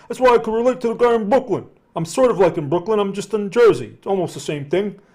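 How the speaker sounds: fast, loud Jersey accent; New Jersey accent. Real, not acted. Relating to a Brooklyn viewer in a loud Jersey accent. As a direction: lean into the New York accent. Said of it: "that's where my accent is from".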